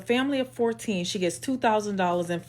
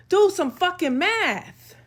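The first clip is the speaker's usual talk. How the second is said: slow, higher, fed-up demand; exasperated demand; 7 semitones higher, slower than usual. Real, not acted. Ranting at commenters, slow and fed up.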